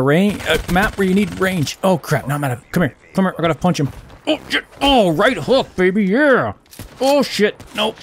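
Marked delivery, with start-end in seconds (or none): 4.3-8.0 excited fight yelps. Real, not acted. Close-range fight.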